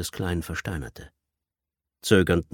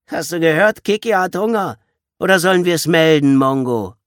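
loud, higher sneering jeer; mocking taunt; 10 semitones higher, 9 dB louder than usual. Pimply boy mockingly taunts the little boy. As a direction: tease him with a grin you can hear. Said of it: "legte den Kopf schief und grinste".

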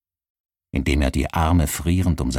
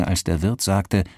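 plain reading voice

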